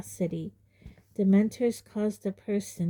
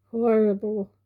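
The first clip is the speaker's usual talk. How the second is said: croaked; 3 semitones higher, 6 dB louder than usual. Acted. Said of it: "croaked Dudley".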